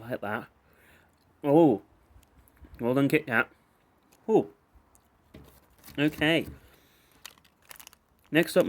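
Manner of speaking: ordinary delivery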